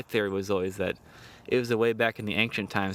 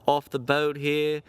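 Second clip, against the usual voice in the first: higher, hammed-up British announcement; mock British accent; 4 semitones higher than usual. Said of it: "drop these dumbass accents now".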